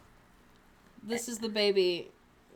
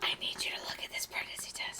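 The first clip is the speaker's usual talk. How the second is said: soft urgent whisper; 8 dB quieter than usual. Acted. Wife wakes husband to see test, whisper.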